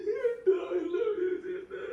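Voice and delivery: crying. Acted. Boy sobs to stranger.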